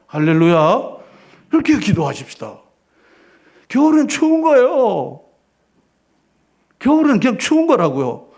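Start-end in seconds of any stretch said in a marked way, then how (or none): 3.7-5.2 겨울엔 stressed high and slow, falling at the end; emphatic slow. Real, not acted. Pastor drilling a simple truth into the flock, slow and emphatic. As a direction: lean hard on 겨울엔, draw it out. Preacher hammering a point.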